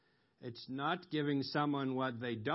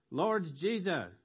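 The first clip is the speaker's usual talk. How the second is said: calling out; 6 semitones higher, 5 dB louder than usual. Retold or imitated. Acts out alternative calls.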